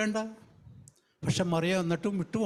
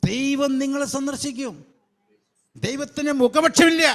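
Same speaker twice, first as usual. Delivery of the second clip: high, loud declaring preacher; preaching proclamation; 7 semitones higher, 6 dB louder than usual. Acted. Preacher assuring the church that God will visit, loud. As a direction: proclaim it as a promise.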